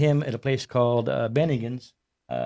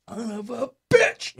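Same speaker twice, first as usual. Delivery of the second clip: higher, slow drawn-out curse; imitating Rusty; 10 semitones higher, slower than usual. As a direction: stretch out the curse, amused. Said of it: "He's like".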